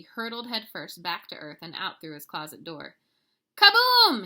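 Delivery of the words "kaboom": very high, loud holler. A narrator's reading. Kitty boy hollering as he crashes home, very loud. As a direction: blast it out as you crash. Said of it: "he hollered".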